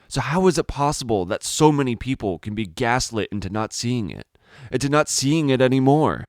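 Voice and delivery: pleading, voice climbing with frustration; rising frustrated outcry. Acted. Narrator railing at a blind public, building to an outcry.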